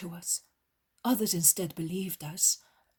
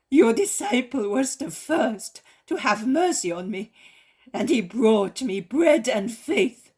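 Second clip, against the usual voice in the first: loud, impassioned begging; fervent plea; 3 semitones higher, 8 dB louder than usual. A narrator's reading. Leper begging Jesus to cleanse her, loud and fervent. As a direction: plead with all your soul.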